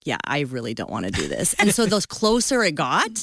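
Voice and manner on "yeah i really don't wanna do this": low, soft reluctant inner voice; inner reluctance. Retold or imitated. Wife's inner thought before the retreat, quiet reluctance. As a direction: drop to a private, unwilling aside. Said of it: "inside you're like".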